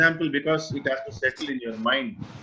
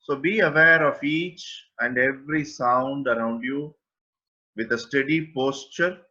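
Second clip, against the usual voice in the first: guided meditation. Acted. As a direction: guide listeners gently into awareness, with long pauses. (unhurried guided-meditation delivery, phrase by phrase)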